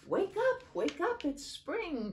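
high, bouncy happy call; cheering. A narrator's reading. The dog bounding into the baby's room, cheering. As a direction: cheer it out like an eager puppy. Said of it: "cheered the dog".

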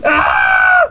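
very high, excited squeal; excited scream. Real, not acted. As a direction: scream with overexcited eagerness. Overexcited urge to touch game figure.

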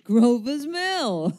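slow and high, breaking into a laugh; bitter laugh. Acted. Stranger scoffing at the place where it began, slow and laughing. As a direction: let the name turn into a wry laugh.

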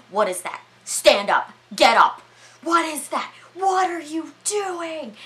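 imitating an outraged man, pitch rising as it goes; outraged demands. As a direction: bark the orders, then stretch out the last question high. Acting out Howard's angry demands.

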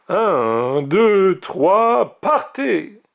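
rising, building start signal; drawn-out countdown rising high; race countdown. A narrator's reading. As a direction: count them off, climbing to the go.

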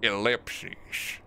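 mock cowboy drawl on one word. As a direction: say it like a hammed-up cowboy. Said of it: "gotta say that in a cowboy voice".

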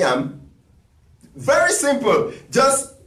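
ordinary delivery

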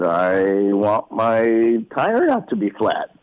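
said slowly and carefully; slow child answer. Retold or imitated. Boy answering his dad's question, slow and careful. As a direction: spell out the wish like a small boy.

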